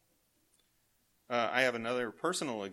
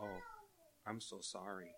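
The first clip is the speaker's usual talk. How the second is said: soft sympathetic sorry; 12 dB quieter than usual. Retold or imitated. Grandson pitying grandpa's stripped-down car, soft sympathetic voice. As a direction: hush it out like a kind grandson. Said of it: "he said it just like that".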